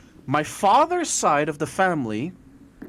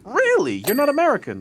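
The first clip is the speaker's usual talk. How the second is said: high, louder disbelief; mock his surprise, pitched up; surprised friend; 11 semitones higher, 5 dB louder than usual. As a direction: friend surprised she is local. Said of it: "He said".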